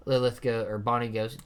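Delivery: usual speaking voice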